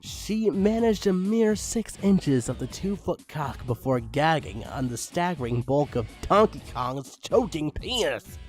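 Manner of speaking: ordinary delivery, nothing marked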